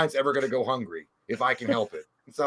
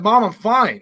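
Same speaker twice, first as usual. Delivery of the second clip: loud, high protest; annoyed son; 8 semitones higher, 8 dB louder, slower than usual. Retold or imitated. Son insists to mom, loud and high. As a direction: insist loudly. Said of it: "then I'm like".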